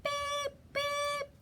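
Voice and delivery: squeaky high beep beep; high truck beep. Shown as a demonstration. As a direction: toot it like a little truck. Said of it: "Let me hear you".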